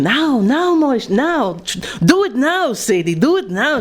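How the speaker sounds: mock urgent cries. Husband at the height of passion, hammed-up and pleading. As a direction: plead in a comic climax. Husband's climax cry in joke.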